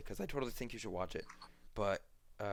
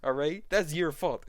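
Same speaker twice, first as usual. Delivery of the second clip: louder, higher exasperated blame; mock scolding; 4 semitones higher, 7 dB louder than usual. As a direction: act out pinning the blame back on kids. Acted scolding, blaming the kids.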